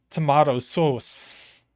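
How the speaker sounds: slow, lightly put-on Australian accent; a hint of a bad Australian accent, drawn out; terrible Australian accent. Teasing Kurban about his tomato sauce, in a lightly put-on bad Australian accent. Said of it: "That was my terrible, terrible Australian accent".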